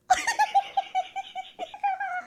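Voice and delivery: high-pitched squeal of laughter; squeaky laugh. Real, not acted. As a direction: burst into a long, high laugh. Laughs at own favourite insult.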